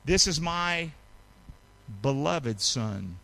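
beloved son drawn out slowly; slow tender blessing. Shown as a demonstration. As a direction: let each word of the blessing land slowly. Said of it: "How about this one?".

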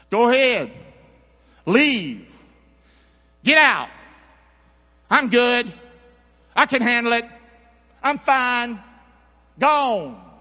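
loud, high shouted preaching; preaching at full force. Acted. Preacher to church, loud and high preaching.

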